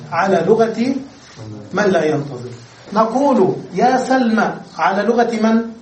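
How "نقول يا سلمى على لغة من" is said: normal talking voice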